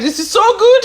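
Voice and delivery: high, excited delight. Real, not acted. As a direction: squeal it with joy. Tasting meat, delighted.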